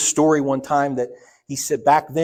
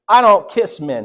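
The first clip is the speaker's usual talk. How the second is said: loud, higher flat refusal; gruff refusal; 7 semitones higher, 9 dB louder, slower than usual. Retold or imitated. Dad refusing Brother Elisha's kiss, a loud refusal. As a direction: refuse him loudly.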